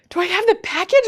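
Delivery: high, loud mock disbelief; retold incredulous question; astonished echo. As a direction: repeat it back, startled and high. Retold startled echo of caller.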